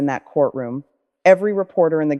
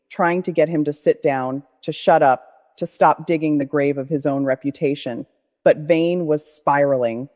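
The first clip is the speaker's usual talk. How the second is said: ordinary delivery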